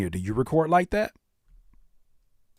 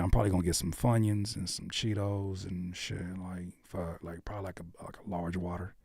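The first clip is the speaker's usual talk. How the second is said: very soft, low, rambling reply; low, trailing everyday mumble to a buddy; casual speaking voice; 5 semitones lower, 9 dB quieter than usual. Shown as a demonstration. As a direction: think aloud quietly about a snack order. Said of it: "We talk like that all day long".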